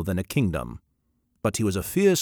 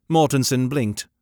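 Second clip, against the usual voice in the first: usual speaking voice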